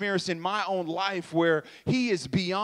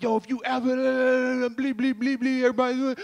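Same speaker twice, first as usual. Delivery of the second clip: censor bleeps; 6 semitones higher, faster than usual. Retold or imitated. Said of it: "my opportunity to create sound effects".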